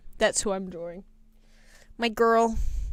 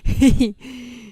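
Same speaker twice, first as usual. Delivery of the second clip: laughing while teasing; 7 dB louder than usual. Real, not acted. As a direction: giggle through the words. Laughing at dog photo.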